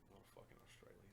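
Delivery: fast angry whisper. Retold or imitated. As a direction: hiss it fast under breath. Angry retold quote, whispered.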